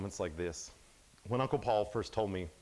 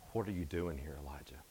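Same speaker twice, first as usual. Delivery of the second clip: much softer, lower, almost gone; the still small voice asking Elijah; low whisper of God; 6 semitones lower, 7 dB quieter than usual. Acted. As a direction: let God's voice barely reach the ear. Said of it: "the sound of a low whisper".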